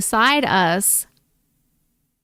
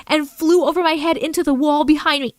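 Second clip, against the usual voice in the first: ordinary delivery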